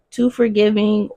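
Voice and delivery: normal talking voice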